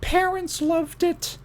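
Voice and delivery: put-on gushing older lady in high falsetto; older woman. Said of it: "And she goes".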